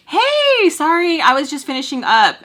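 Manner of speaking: high, loud, singsong "hey" opening; fake cheery greeting. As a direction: put on a bright door-greeting voice.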